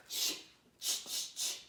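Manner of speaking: a whispered ch sound, repeated; unvoiced ch sound for the class; digraph sound. Shown as a demonstration. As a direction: sound out ch ch ch softly. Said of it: "What digraph goes ch?".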